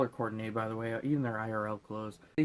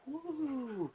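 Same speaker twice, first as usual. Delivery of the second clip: high drawn-out "woo"; imitated emerging whoop; 13 semitones higher, 4 dB quieter than usual. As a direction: let out a high mock whoop. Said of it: "he comes out of the ooze like this".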